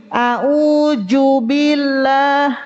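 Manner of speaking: A'udzu billah, slow, with a "j" sound: aujubillah; dhal as jim. Shown as a demonstration. Teacher imitates the wrong jim reading. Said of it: "Ada juga jadi jim".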